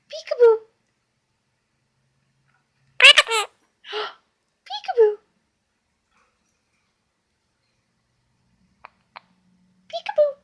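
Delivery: very high playful peekaboo call. Real, not acted. Playing peekaboo with bird.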